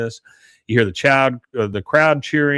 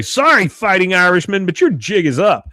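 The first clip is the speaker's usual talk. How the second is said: high, punchy announced taunt; mock taunt; 7 semitones higher, 4 dB louder than usual. Cheerleader challenging the leprechaun, high mock taunt. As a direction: imitate a cheerleader calling out a challenge.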